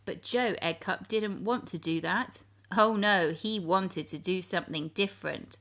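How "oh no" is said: plain reading voice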